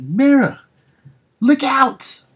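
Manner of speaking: high, loud yell of alarm; frantic battle cry to Mara; warning shout. Acted. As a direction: scream the warning across the fight.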